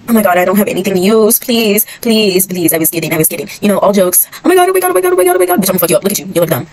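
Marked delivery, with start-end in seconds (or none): 0.1-5.6 loud panicked pleading. Real, not acted. Player begging loudly with nothing left to use. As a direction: beg loudly as you panic.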